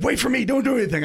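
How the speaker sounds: high, loud and fast, a friend rushing to stop a fight; urgent warning. Retold or imitated. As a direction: blurt it out like a buddy panicking on the phone. Friend urgently warns on phone.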